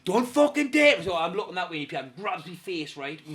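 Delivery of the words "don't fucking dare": high, loud warning; shouted threat. Retold or imitated. Hijacker warning the driver as a car passes, shouting. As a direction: snap it loudly, imitating a hijacker.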